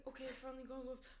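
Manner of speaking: rushed words, then panting; fast quote breaking into panicky breaths; mock hyperventilating. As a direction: imitate a man hyperventilating. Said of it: "He's, like, hyperventilating".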